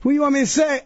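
ordinary talk, nothing marked

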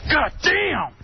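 hamming up a friend's frustrated curse; retold outburst. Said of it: "and he goes".

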